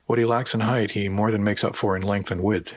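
plain reading voice

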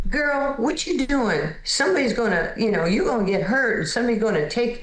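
imitating a big woman telling her off; scolding neighbor lady. Neighbor lady warns the young player, in a high mock scold.

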